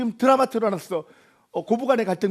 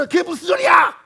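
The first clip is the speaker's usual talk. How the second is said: indignant retort; 5 semitones higher than usual. Acted. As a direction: demand he fix it now. Fiancee's heated retort.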